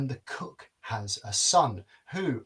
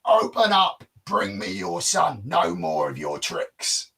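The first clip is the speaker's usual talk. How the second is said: much louder and higher, roaring at the gate; furious giant shout; 5 semitones higher, 5 dB louder than usual. Acted. Giant roaring at the castle gate for the prince, much louder. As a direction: bellow the demand like an enraged giant.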